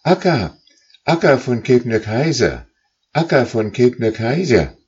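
high, loud calls across the sky; calling out. Acted. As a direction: call after the leader goose from far behind. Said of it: "riefen sie der Gans".

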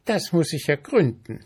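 high, energetic ant voice. A narrator's reading. Ant resolving to investigate, energetic. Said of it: "sagte die Ameise energisch".

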